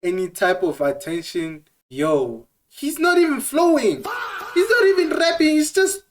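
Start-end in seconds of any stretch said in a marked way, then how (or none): 2.7-6.0 laughing shout. Real, not acted. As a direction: yell it while cracking up. Mocking the rapper, laughing.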